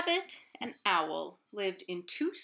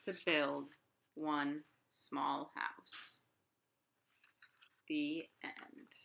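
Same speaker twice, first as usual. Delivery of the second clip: slow, softer closing line; slow gentle ending; 4 semitones lower, 6 dB quieter, slower than usual. A narrator's reading. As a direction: let the story land slowly. Story ending softly.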